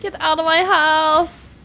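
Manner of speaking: loud, slow, drawn-out shout; indignant, stretched-out yell; yelling at an intruder. Real, not acted.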